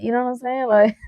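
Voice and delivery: usual speaking voice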